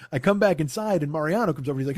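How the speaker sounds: ordinary talk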